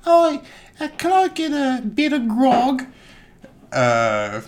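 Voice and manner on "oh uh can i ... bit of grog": hammed-up lady voice. Lady orders at saloon.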